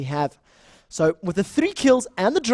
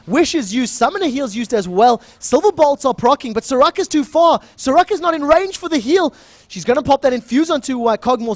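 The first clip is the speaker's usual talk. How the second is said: excited play-by-play; 5 semitones higher, 5 dB louder than usual. Acted. Live play-by-play of fight.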